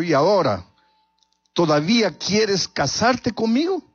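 crying. Acted. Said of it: "llorando le dijo". (pregunta llorosa, aguda y lenta)

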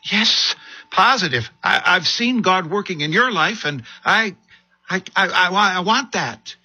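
high, trembling with fear; scared pleading. Acted. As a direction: beg for help, stammering. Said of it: "No, Mom, I'm scared".

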